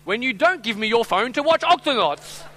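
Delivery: high, loud child impression; hammed-up, high kid complaint; little daughter's voice. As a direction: play an indignant little girl. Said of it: "I hear this little voice in the back".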